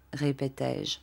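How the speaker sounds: usual speaking voice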